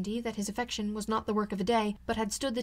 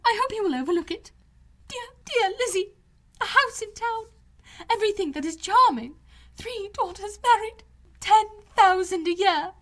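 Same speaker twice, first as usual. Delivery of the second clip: much higher and louder, breathless with delight; gushing excitement; 11 semitones higher, 5 dB louder than usual. A narrator's reading. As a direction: gush like an overjoyed mother who can't stop. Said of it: "wonder, and bless herself".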